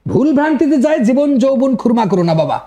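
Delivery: normal talking voice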